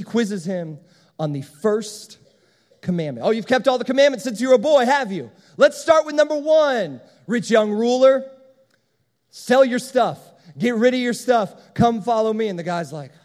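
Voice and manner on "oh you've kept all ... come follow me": loud, higher-pitched testing challenge; mock challenge. Jesus quizzes the rich young ruler, challenging him loudly. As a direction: call his bluff, raised voice.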